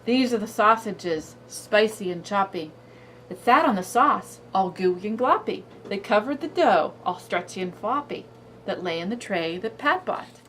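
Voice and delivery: lively singsong read-aloud; reading to a toddler. Shown as a demonstration. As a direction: read it bouncy for a little kid. Reads cumulative story aloud.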